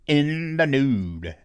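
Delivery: slowed-down mock sexy murmur; slow sultry drawl. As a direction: purr it like a cheesy seducer.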